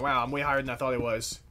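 ordinary talk

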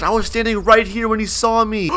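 ordinary talk, nothing marked

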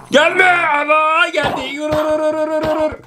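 high, urgent panic; panicked cry. Real, not acted. Gamer fleeing an approaching enemy, panicked cry. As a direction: yelp and hurry everyone away.

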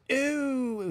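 high, drawn-out sarcastic ooh; Cam's sarcastic voice. Retold or imitated. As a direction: tease with a sneering ooh. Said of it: "Cam has this voice that he does when he wants to be sarcastic".

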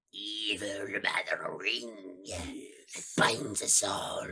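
slow, low, whispered wizard; low whispered Gandalf impression. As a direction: hiss it like a menacing old wizard. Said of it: "That was Gandalf pretty spot on".

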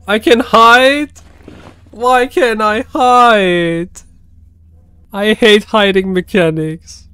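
high, loud, slow whine; a high, slow, loud whining gripe; whiny complaint. Real, not acted. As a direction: drag it out in frustration. Frustrated at hiding in game.

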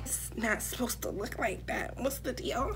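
hushed mock panic, very soft; retold panic. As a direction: panic quietly, almost to yourself. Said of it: "I'm panicking".

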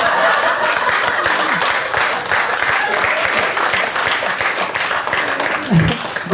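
long airy laugh at own joke; long breathy laugh. Real, not acted.

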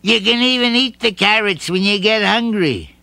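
proud little gift-giver; a hint of bunny voice; lightly voiced Bouncer. A narrator's reading.

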